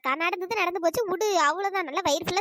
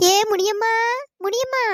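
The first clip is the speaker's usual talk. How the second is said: raised, louder call to a neighbour; 4 semitones higher, 6 dB louder than usual. Acted. Friend calls to the crying woman next door, calling out. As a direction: call her name out, pitched up.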